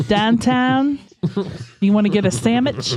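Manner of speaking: mock Pittsburgh local, "sandwich" as "sammich"; Pittsburgh accent. Shown as a demonstration. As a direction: play up Pittsburgh vowels and "sammich". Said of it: "that Pittsburgh accent".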